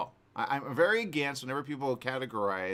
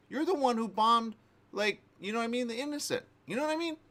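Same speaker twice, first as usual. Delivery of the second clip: high-pitched outraged retort; worked-up and incredulous; indignant; 12 semitones higher, 4 dB louder than usual. Real, not acted. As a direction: protest the hypocrisy, voice shooting up. Indignant retort at Putin's claimed victimhood.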